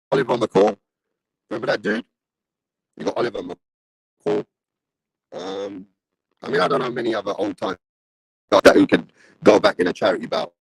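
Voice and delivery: crackly, cutting-out live-stream audio; broken mic. Real, not acted. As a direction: voice breaking up through a shorting mic. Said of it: "I sound like staticky".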